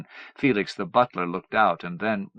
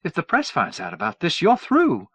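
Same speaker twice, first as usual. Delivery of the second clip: rising alarm, higher and louder; 6 semitones higher, 5 dB louder than usual. A narrator's reading. Mother warning her son, alarmed and louder. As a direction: warn him he is finished, voice climbing.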